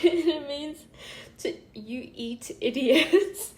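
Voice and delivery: very loud, laughing through the words. Real, not acted. Explaining a friend's Chinese slip, real laughing. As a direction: crack up on the translation.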